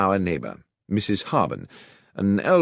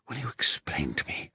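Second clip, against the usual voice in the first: whispered, puzzled question; soft whisper while hiding; hushed demand; 4 semitones lower, 9 dB quieter than usual. Acted. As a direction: ask it under your breath.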